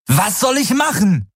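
high-pitched, exasperated cry; angry desperation. A narrator's reading. Pilot answers crewmate, angry and desperate cry. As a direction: fire back in open despair. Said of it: "ohne seine eigene Wut oder Verzweiflung zu verhehlen".

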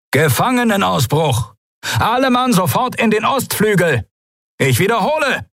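very high, urgent alarm call; acted PA alert at high pitch; thundering announcement. A narrator's reading. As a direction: bellow orders as over a loudspeaker. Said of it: "donnerte plötzlich die Stimme eines Menschen".

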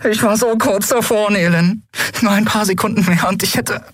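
high-pitched lament; anguished frustration. A narrator's reading. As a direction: lament how close it was. Heroine laments the lost password. (verzweifelt klagend)

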